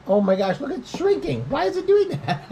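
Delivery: surprised. Real, not acted. Food shrinking on camera.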